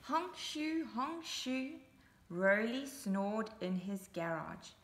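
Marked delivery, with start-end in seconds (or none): 0.0-1.7 mock snore, sing-song; honk-shoo snoring noise; playful snoring for kids. Snoring sound effect for Roly.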